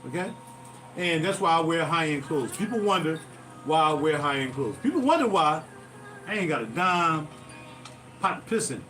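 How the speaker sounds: ordinary talk, nothing marked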